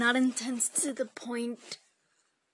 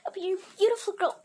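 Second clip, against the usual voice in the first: higher mock-sweet mom voice; mimicking mom; 8 semitones higher than usual. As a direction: play the mom, lifted and cooing. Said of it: "my mom was like".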